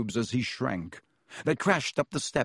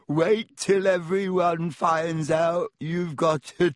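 slow, louder, higher cackling taunt; guffawing ghost; 5 semitones higher, 3 dB louder, much slower than usual. Acted. Myrtle taunting Hermione about her tail with a guffaw. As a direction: crow over her with a guffaw. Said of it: "a hearty guffaw".

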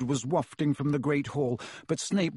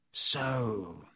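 soft, low menacing teacher; quietly dangerous; a hint of a cold, soft Snape; 3 semitones lower, 6 dB quieter than usual. A narrator's reading. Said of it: "he said softly".

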